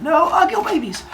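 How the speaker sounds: very high, silly cartoon voice; squeaky sealing of the deal; mock pledge. Both seal the deal shaking hands.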